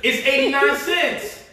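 high, loud and slow hype; excited shout. Real, not acted. Host urging viewers to buy the cheap stock, shouting. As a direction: shout it like a sure bet.

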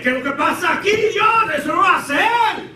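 high, worked-up Spanish outburst; Peter. Acted. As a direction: protest in heated, high-pitched Spanish. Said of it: "So that's how Peter was acting".

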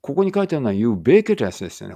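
usual speaking voice

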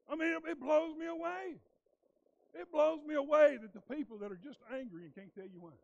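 high, loud preacher's build, rising to fervour; fiery preaching. Acted. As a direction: preach it out to the whole congregation. Preaching at full force.

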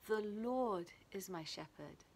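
"Lord" drawn out and weighted; stress on LORD. Shown as a demonstration. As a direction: lean on the word "Lord", the rest lighter. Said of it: "the emphasis on each word".

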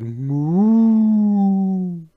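long, loud, high moo; big drawn-out cow call. A narrator's reading. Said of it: "So the cow said".

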